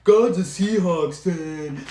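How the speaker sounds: high, slow mocking voice; mocking Russell Wilson. Fan mocking the quarterback's comment, high and slow. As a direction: drag it out like a smug quarterback. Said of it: "Russell Wilson's like".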